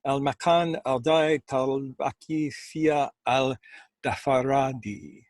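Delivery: measured, unhurried storytelling voice; story narration. A narrator's reading. Host reading a Sufi story to listeners, calm storytelling. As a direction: read the tale like a spoken legend, letting pauses breathe. Reads a Sufi tale aloud.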